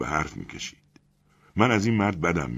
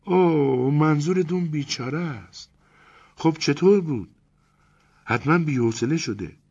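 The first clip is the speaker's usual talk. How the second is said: high woman's voice, stumbling over من; hesitant Elena; 10 semitones higher, 3 dB louder than usual. Acted. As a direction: Elena tells narrator she wants separation, hesitant.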